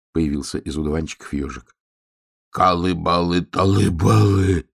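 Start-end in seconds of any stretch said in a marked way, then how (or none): 2.5-4.7 slow gibberish chant of a winded cub; breathless babbling. A narrator's reading. Bear cub babbling gibberish to the hedgehog, breathless babble. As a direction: babble the nonsense syllables, drawn out. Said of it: "запыхавшись, забубнил медвежонок".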